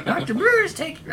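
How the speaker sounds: put-on very high old lady voice, rushed; high old woman voice. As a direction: squeak it out quickly like an old woman. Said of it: "drop that voice".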